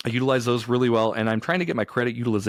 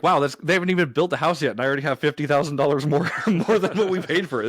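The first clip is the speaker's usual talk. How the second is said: higher-pitched retold disbelief, ending in a chuckle; amazed; 5 semitones higher than usual. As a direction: marvel at your own luck. Retells own amazed reaction to equity.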